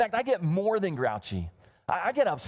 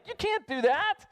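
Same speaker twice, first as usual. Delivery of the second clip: mock protest; 13 semitones higher than usual. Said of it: "And I was like".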